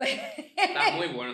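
slow, laughing praise; drawn out with a laugh. Real, not acted.

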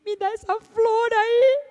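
high, slow put-on voice; a slow, high little demand; character voice. Character's demand in retold tale.

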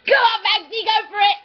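loud, high egging-on; excited cheering. Real, not acted. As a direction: cheer the pet on through laughter.

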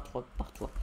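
breathy whispered echo of trois par trois; quiet whispered aside; whispered repeat. Shown as a demonstration. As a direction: whisper it back to yourself. Repeating the mining count.

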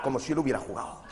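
ordinary talk

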